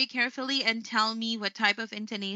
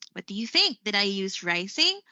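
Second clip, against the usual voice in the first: ordinary delivery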